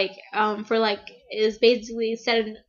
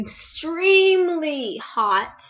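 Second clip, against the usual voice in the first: higher, very slow and drawn-out swoon; 4 semitones higher, much slower than usual. Real, not acted. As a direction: stretch the words out, melting over him. Gushing over her celebrity crush.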